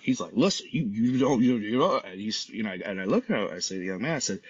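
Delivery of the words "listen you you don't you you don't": imitated sputtering comeback; about to bark. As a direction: start barking back, tripping on the words. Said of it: "he's, like, about to bark".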